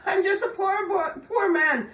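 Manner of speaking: high, loud whiny plea; whining leprechaun. Acted. Caught leprechaun whining to Tom to let him go. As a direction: whine and wheedle for mercy. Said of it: "wiggled and twisted and whined".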